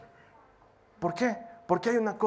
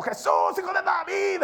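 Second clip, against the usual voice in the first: mock cry to Jesus, higher at the end; 10 semitones higher than usual. Blind Bartimeo crying out to Jesus, pleading call. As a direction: call out "Jesús" as the blind beggar. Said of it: "él estaba gritando".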